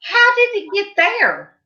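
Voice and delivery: high-pitched incredulous question; retold astonished question. Mom questioning daughter on the phone, retold astonishment. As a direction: replay your own disbelief, pitched high.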